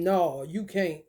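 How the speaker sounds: low, slow scolding voice; lightly voiced gatekeeper; mock scolding. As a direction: drop low and forbid it. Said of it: "I'm trying to tell you on some".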